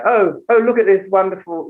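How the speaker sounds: put-on affected British accent, pitched high; mock-posh British gushing; Gwyneth Paltrow. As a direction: put on a fake English accent. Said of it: "had the accent".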